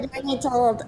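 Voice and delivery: squeaky high cartoon voice; helium voice. Shown as a demonstration. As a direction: pitch way up like sucked helium. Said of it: "the helium voice that people do".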